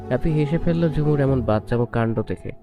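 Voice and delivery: plain reading voice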